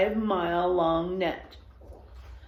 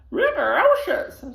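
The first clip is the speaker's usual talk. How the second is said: sobbing; 12 semitones higher, 8 dB louder than usual. Acted. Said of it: "sobbed Spit Arroyo".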